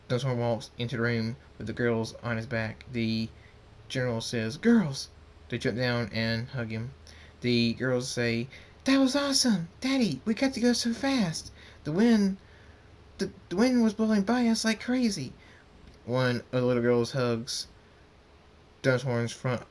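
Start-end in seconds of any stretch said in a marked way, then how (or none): 4.6-5.1 calling out. A narrator's reading. Father greets returning daughters. 8.8-15.3 put-on high, breathless childlike gushing; excited little girls. A narrator's reading. Daughters telling their dad about the flight, high excited gushing.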